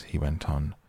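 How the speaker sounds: ordinary delivery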